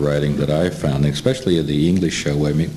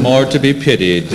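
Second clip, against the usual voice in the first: said through a real laugh, higher and louder; 9 semitones higher, 4 dB louder than usual. Real, not acted. Host laughing back at the guest's joke, laughing. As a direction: chuckle out the comeback.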